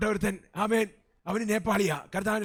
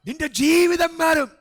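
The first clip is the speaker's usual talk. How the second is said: slow, much higher and louder proclamation of നിന്റെ ജീവിതം മാറും; preaching shout; 9 semitones higher, 10 dB louder, slower than usual. Real, not acted. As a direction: shout the promise out to the whole church. (ഉച്ചത്തിൽ പ്രസംഗിക്കുന്ന വിളി)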